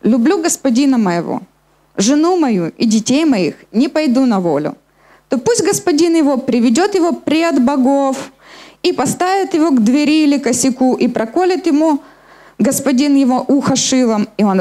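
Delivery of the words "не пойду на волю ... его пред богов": normal talking voice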